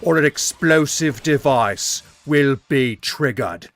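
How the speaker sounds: loud, slow, drawn-out Australian villain; Australian accent. Acted. Bomber giving the store worker an ultimatum, Australian accent. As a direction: deliver bomb threats in broad Australian. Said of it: "Why did he turn Australian".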